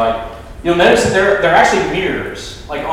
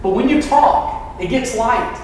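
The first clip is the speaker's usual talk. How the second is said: high-pitched imitation of a small child; little boy; 7 semitones higher than usual. Little boy answers his auntie from bed, high child voice. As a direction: voice a young boy, pitched well up. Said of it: "the little boy replied".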